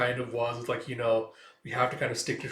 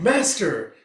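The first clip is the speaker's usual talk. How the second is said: grateful exclamation; 6 semitones higher, 9 dB louder than usual. Acted. Student calls out gratefully.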